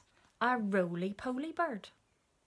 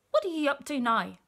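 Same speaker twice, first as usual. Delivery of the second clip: higher, louder monkey voice; inquisitive monkey; 6 semitones higher, 7 dB louder than usual. A narrator's reading. Monkey asks crocodile his business, high and loud. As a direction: pipe up with nosy interest.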